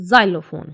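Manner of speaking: "xylophone" starting with a clear z sound. Shown as a demonstration. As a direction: open "xylophone" with z, not ks. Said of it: "हम इसको xylophone नहीं पढ़ेंगे". ("xylophone" ज की आवाज़ से)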